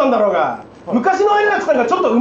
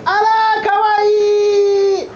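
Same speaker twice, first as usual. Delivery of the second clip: mock cooing; 7 semitones higher, much slower than usual. Balloon bit, cooing at it.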